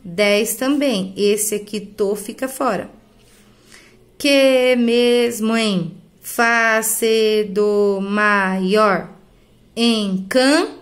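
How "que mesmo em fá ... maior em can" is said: very slow, separated syllables; drawn-out syllables, stopping on the stressed one; syllable-by-syllable scansion. Shown as a demonstration. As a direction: count out the verse by sound. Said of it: "Até a sílaba tônica".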